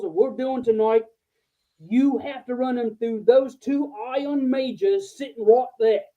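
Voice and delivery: bad Australian accent. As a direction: lay down the law in a hammed-up Australian accent. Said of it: "I'm gonna do a really bad accent".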